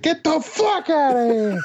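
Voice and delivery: very high, slow, laughing yell; Captain America yelling at a knocked-down enemy, slow and laughing; tough-guy yell. Acted. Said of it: "he's like".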